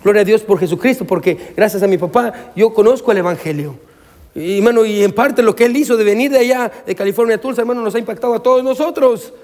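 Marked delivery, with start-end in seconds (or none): 1.6-9.3 lifted, higher-pitched preacher's voice; impassioned preaching. Real, not acted. Pastor thankful for his father-in-law's move, impassioned preaching. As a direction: testify with rising fervour to the congregation.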